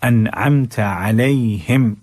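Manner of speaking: very slow chanted verse; Quran recitation. Shown as a demonstration. Teacher recites the verse slowly as a model. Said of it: "गै पढ़ना है".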